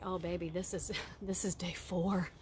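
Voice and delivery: whispering gently with a little laugh; soft tender whisper. Retold or imitated. Mother whispering softly to her sad son. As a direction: hush it like comforting a sad child. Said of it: "And I'm like".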